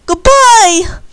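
high, loud farewell shout; calling goodbye. A narrator's reading. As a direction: call out across the distance. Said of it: "Annie waved goodbye and said".

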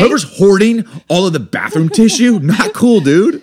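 loud, high joking complaint; mock outrage. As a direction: scold the hoarders playfully. Joking rant about toilet paper.

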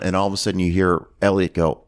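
usual speaking voice

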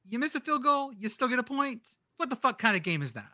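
a hint of a smirking Vegas wise guy; fast, lightly voiced wise guy. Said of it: "a Vegas wise guy voice".

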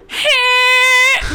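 very high, loud squeal; match the bagpipe drone; piercing held squeal; Phoebe's bagpipe harmony. Retold or imitated. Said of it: "she's like".

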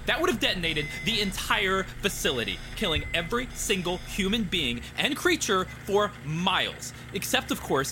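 high, fast, agitated rant; angry technician. A narrator's reading. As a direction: rattle it off in a fury. Obayashi angry outburst.

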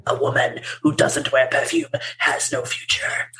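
whispery, scary quote reading; mock ominous. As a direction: read it like a bleak, hushed warning.

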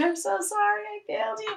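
put-on mother in a dream apologizing to her kids, crying; crying apology. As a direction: sob out the apology lightly. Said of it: "crying and saying".

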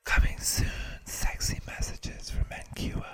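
loud stage whisper into the mic; forceful, airy mock-sexy whisper. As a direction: whisper it as a teasing promo. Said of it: "Say that into the mic in a whisper".

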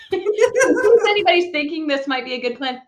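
high, loud, words bursting through laughter; laughing. Real, not acted. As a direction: laugh while warning the listeners. Laughing at her own bad plan.

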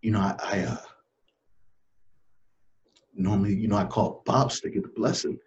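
choked up, with long faltering pauses; getting emotional. Real, not acted. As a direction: struggle to get it out through emotion. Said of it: "getting a little emotional thinking about it".

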